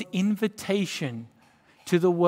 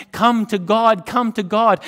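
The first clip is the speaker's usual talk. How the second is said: louder, higher insistent calling; loud prophet's call; 5 semitones higher, 9 dB louder than usual. Acted. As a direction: call the people to God. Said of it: "Isaiah is pointing people and saying".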